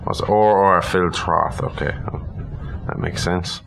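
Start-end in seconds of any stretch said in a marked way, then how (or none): none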